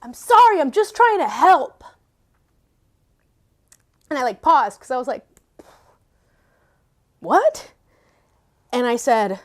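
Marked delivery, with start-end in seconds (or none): 0.0-1.8 high, pleading little voice, loud; little inner voice. Retold or imitated. Little voice in her head answering the scolding, pleading. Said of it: "this little voice inside my head say". 7.2-7.7 high, louder, sharply rising question; retold shock at an unexpected answer; startled disbelief. Stopped short by a voice in her head, startled.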